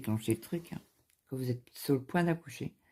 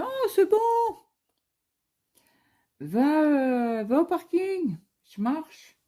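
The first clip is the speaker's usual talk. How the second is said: much higher and louder, brushing it off; mock high insistence; 5 dB louder, slower than usual. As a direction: squeak out that it's nothing, all fake bravado. Insisting to husband she's fine.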